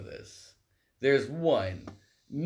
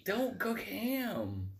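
mock pleading; 8 semitones higher, much slower than usual. Mock plea against ham.

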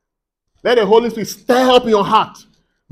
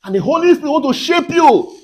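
higher-pitched, punchy preaching; forceful exhortation; 4 semitones higher than usual. Real, not acted. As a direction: urge the congregation with emphatic commands.

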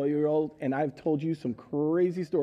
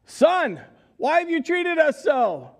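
higher, louder worried parent scolding; upset parent; 9 semitones higher, 5 dB louder than usual. Retold or imitated. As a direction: parents confront their son after searching. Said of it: "and they say".

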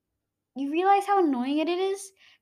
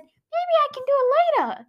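imitating dad; 14 semitones higher, 6 dB louder than usual. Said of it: "And he said".

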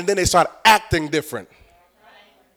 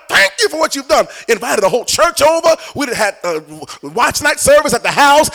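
high, exuberant shouted thanks; elated, raised preacher's praise; jubilant retelling; 6 semitones higher, 5 dB louder than usual. Retold or imitated. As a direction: relive the joy, praising God at full voice. Relives joyful thanks for new home.

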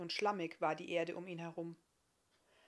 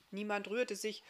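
plain reading voice

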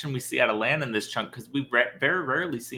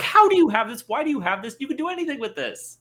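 much higher and louder, demanding questions; irritated outburst; 11 semitones higher, 6 dB louder than usual. Retold or imitated. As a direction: confront her about the letter. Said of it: "He's irritated. He's frustrated".

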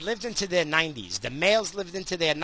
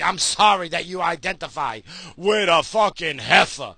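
normal talking voice

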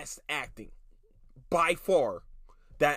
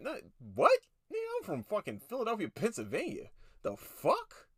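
indignant retort; 7 semitones higher than usual. Retold or imitated. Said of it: "retort to people asking his origin".

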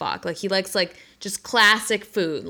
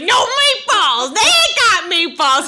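high, loud, drawn-out mock scream; hammed-up dad bellow; yelling at everyone; 11 semitones higher, 7 dB louder, slower than usual. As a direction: holler it like a furious dad warning strangers. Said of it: "He's, like, yelling at everyone".

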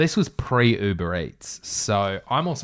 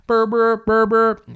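high, loud imitated phone ring; 12 semitones higher, 9 dB louder, slower than usual. Reader imitating the phone ringing before Ben calls. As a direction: make the phone ring with your voice.

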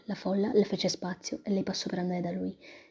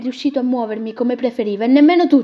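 much louder, defiant retort; angry; 4 semitones higher, 11 dB louder than usual. Acted. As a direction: stare him down and tell him off.

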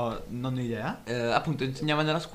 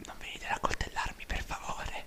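hushed pleading whisper; 9 dB quieter than usual. Acted. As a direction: whisper it as a scared plea. Fearful plea to unseen stabbers.

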